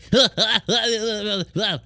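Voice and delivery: high amused laugh after the mock line. Real, not acted. Streamer laughs at his own troll impression, high laugh.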